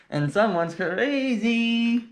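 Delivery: sing-song high and slow; playful high slow voice. Acted. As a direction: tease it out slowly, up high. Playful aside to the baby.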